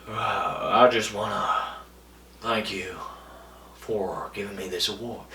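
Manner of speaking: low, soft, slurred [name]-style voice; low hushed [name] accent; [name] drawl. Retold or imitated. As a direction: mumble it like [name] accepting an award. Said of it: "he talked like".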